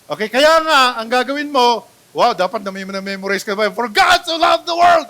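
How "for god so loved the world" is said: For God so loved the world shouted high and loud like anger; mock angry verse. Preacher acting out angry speech turned into scripture, mock furious shout. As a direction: fling the verse like an angry outburst. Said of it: "parang galit ka".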